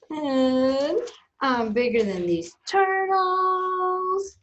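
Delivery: high, slow brag; boastful squid. Acted. Reader as squid outdoing the turtles, boastful. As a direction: crow it, stretching the last word. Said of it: "he's very boastful".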